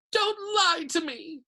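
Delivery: high, drawn-out hurt wail; tearful gasp. A narrator's reading. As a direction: wail it through welling tears. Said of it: "Myrtle gasped".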